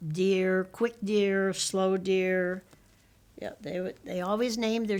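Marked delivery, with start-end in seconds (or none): none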